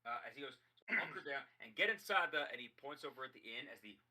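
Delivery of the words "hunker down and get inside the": raised, higher voice; urgent sheriff's order. Acted. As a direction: call out a loud command. Sheriff orders townsfolk inside.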